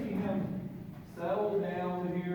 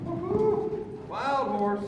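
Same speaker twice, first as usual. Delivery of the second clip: high, loud mock cowboy holler; playful whoop; 9 semitones higher, 5 dB louder, much slower than usual. Trainer whooping at his frisky horse, playful excited holler. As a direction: whoop it up, then call it out high.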